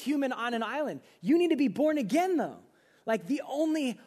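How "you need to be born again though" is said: emphatic call. Real, not acted. Preacher's opening exhortation.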